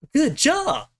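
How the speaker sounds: mock praise. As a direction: squeak out the friend's approval. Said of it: "my companion's like".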